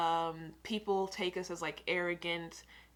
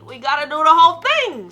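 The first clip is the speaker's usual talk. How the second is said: hyped; 9 semitones higher, 19 dB louder than usual. Real, not acted. Hyped vlog intro line.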